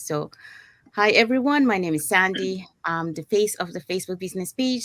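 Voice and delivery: normal talking voice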